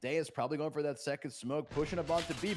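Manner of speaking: ordinary delivery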